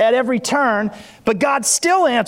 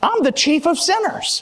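preaching at full force, raised and high; 4 semitones higher than usual. Acted. Pastor preaching to the church about testimonies, full force. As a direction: fire up the congregation from the pulpit.

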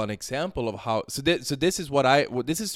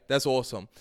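ordinary delivery

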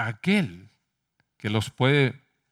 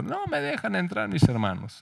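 put-on a light whimpering child's voice, a bit higher and softer; crying little girl; 4 semitones higher, 5 dB quieter than usual. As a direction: play the crying daughter, softly. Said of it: "vino Judith llorando".

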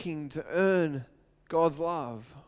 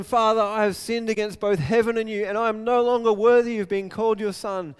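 raised, higher pleading voice of the repentant son; pleading confession; 5 semitones higher, 7 dB louder than usual. Acted. Son confessing his sins to his father, raised pleading. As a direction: beg forgiveness out loud, heart on sleeve.

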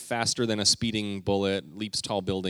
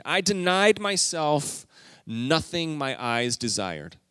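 scripture reading; 5 semitones higher, 3 dB louder, slower than usual. Acted. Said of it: "reading scripture".

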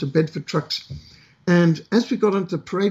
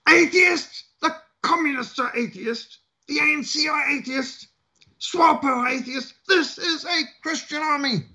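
scowling sergeant major; 9 semitones higher, slower than usual. Retold or imitated. Sergeant major scolding the atheist recruits: mock outraged rant. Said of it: "The Sergeant Major scowled and said".

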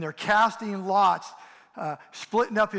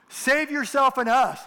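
higher, louder scornful shout; railing insult; 7 semitones higher, 7 dB louder than usual. Retold or imitated. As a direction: hurl it at him in contempt. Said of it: "he railed at Jesus. He hurled insults at him".

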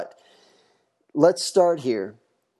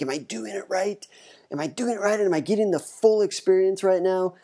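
anxious type A worrier; 6 semitones higher than usual. Retold or imitated. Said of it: "you type A freak people like myself, you're gonna be like".

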